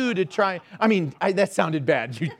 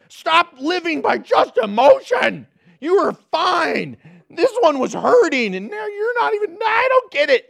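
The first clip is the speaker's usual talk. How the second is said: rising, louder pleading with toddlers; exasperated dad; 5 semitones higher, 4 dB louder than usual. Retold or imitated. Dad at the nursery pleading with crying kids, exasperated. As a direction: beg the kids to stop, frazzled.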